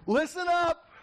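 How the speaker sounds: sharp call for attention. Shown as a demonstration. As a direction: bark it out to snap ears awake. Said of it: "Or I might".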